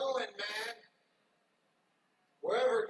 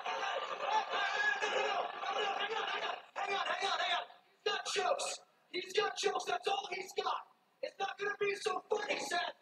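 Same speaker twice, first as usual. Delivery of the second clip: indignant promo voice; 7 semitones higher than usual. Acted.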